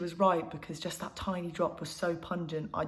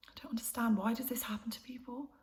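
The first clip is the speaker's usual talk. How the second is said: higher-pitched, anguished plea to understand; distressed questioning; 4 semitones higher than usual. Acted. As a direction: ask in helpless despair. Despairing questions about grandmother.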